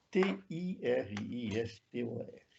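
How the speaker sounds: letter-by-letter spelling. Shown as a demonstration. Professor spells his Portuguese version of the term, letter by letter. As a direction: spell it out, each letter on its own.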